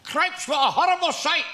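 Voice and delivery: high, loud mock heckler from the third row; drunk Glasgow heckler. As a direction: bellow it like a drunk in the stalls. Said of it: "He said".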